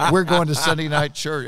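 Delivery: the demand repeated as a final word; insistent kid. Retold or imitated. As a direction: plant your feet and insist like a stubborn seven-year-old. Child insists to his parents.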